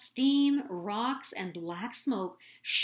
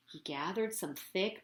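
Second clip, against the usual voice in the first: plain reading voice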